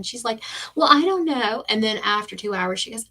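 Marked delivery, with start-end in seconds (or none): 0.7-1.6 mocking receptionist.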